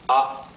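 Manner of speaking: brief tapped "ah"; light quick "ah"; neutral tone. Shown as a demonstration. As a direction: say "ah" short and flat. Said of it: "gently tap something".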